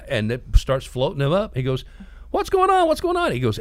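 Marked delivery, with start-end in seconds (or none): 2.3-3.3 very high-pitched squeal of alarm; panicked cartoon guy. Balloon buyer lifted off the ground, mock panic. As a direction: squeal it like someone being carried off.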